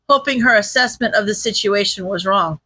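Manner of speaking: ordinary delivery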